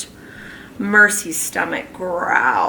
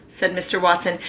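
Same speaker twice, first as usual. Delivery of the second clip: ordinary delivery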